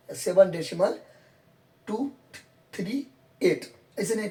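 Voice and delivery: usual speaking voice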